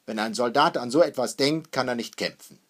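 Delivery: loud, firm rebuff; dismissive. A narrator's reading. Father lectures his wife on soldiers, dismissive. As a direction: shut the worry down with authority. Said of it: "sagte Hackendahl abweisend".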